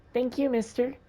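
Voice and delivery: put-on little girl. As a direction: play an eager little girl.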